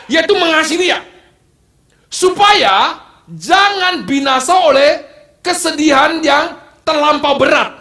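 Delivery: high, loud preaching with laughs along the way; fiery preaching. Acted. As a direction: preach it out to the congregation. Preacher at full force. (khotbah berapi-api dengan suara tinggi dan keras)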